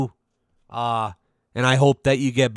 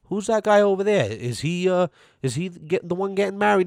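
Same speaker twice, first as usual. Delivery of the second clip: raised, wondering voice of a stranger at the wedding; curious wedding guest; 5 semitones higher than usual. Pretend. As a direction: play a puzzled guest squinting at the crowd. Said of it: "I wanna go".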